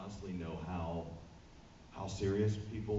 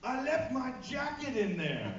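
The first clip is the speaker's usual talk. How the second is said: high, slow, laughing put-on fan voice; fan's excuse; slower than usual. Fan explains to the guard in a high slow laughing voice. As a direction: play the fan's flimsy excuse slowly. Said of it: "And she's like".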